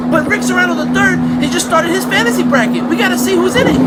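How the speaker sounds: loud shouted excuse over music; yelled excuse. Acted. Skit driver shouting excuse.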